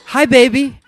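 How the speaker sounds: put-on high, loud, beaming "Hi, baby" called across the room; a mother waving and calling from the sixth row; mom calling out. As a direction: shout a delighted greeting to your son from the pews.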